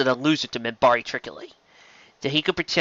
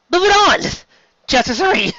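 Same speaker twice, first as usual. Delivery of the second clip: brisk reset; 6 dB louder than usual. Real, not acted. Reader recovering, pushing on.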